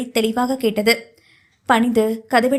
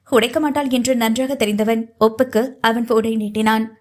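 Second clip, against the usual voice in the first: usual speaking voice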